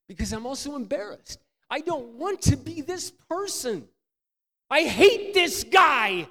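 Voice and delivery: rising to a loud, high, slow cry; anguished self-loathing. Retold or imitated. Preacher confessing his self-hatred, building to a loud cry. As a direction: break open with shame. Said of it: "tears streaming".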